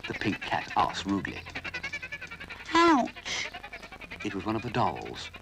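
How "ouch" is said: very high loud yelp of pain; high pained "Ouch!"; sharp ouch. Acted. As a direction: doll yelping when her foot is crushed. Doll cries out.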